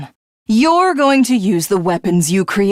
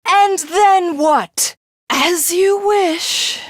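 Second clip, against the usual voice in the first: mocking; 8 semitones higher, slower than usual. Villain mocks hero's resolve.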